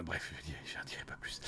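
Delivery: whispered aside, holding back a secret; conspiratorial whisper to the chat; secretive whisper. Real, not acted. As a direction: drop to a hushed whisper, as if saying too much.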